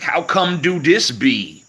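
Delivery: high, loud, slow mock-dumb question. Streamer mimics bad grammar to annoy a pedant, high and slow. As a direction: play deliberately stupid to annoy him. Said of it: "say shit that just pisses him off".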